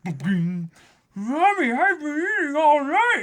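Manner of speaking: high, childish cartoon whine; Luffy whining. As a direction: whimper to mommy like a kid.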